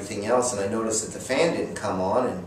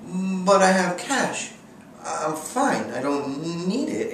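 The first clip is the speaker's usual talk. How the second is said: mock protest; 8 semitones higher, slower than usual. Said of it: "And I was like".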